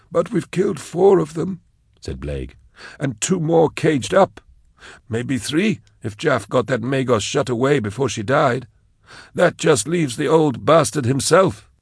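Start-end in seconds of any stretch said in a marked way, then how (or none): 0.1-1.6 higher, thin henchman voice; Blake's voice. A narrator's reading. Blake's character voice. 3.0-11.7 higher, thin henchman voice; Blake's voice. A narrator's reading. Blake's character voice.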